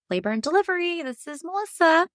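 high, chirpy work-phone greeting. Retold or imitated. Coworker Melissa answering the delivery ward phone, high and chirpy. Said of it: "she's like".

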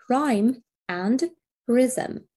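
slowly, each word set apart; slow key terms. Shown as a demonstration. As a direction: spell out the terms for students. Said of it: "teacher stresses key terms".

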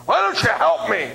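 high, loud, slow grumpy demand; cranky demand. Retold or imitated. Old passenger demanding help, high, loud and slow. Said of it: "And the whole plane is staring".

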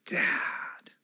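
put-on 1 kids yell, whispered. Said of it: "my kids would yell".

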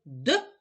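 «д» as a short hard d, not palatalized; hard consonant sound. Shown as a demonstration. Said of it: "Твердий приголосний звук".

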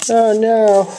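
high, loud playful wail; mock dismay. Real, not acted. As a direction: cry out in hammed-up dismay. Dismay at repeat card.

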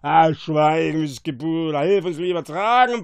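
loud, irritated roar; rough drunken yelling; bellowing. A narrator's reading. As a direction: bellow an order to shut up and help. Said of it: "brüllte Trincolo".

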